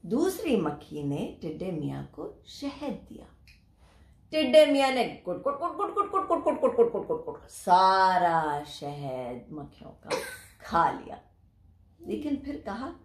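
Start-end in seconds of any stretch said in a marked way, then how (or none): 4.3-10.1 gulping sounds. Acted. Grasshopper gulping the honey.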